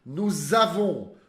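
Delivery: Nous avons with a z liaison, very slow. Shown as a demonstration. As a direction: link nous avons with a buzzing z. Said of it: "Som de Z". (ligação com som de Z em nous avons, bem devagar)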